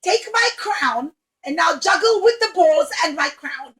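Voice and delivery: loud demanding royal orders; bossy princess. Acted. Princess orders clown to juggle her crown, bossy. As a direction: command it carelessly. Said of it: "and she said".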